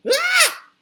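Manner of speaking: very high loud cub shriek; a tiny bear's piercing shriek. Acted. Baby Bear sees his broken chair, shrieking. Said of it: "he let out a shriek".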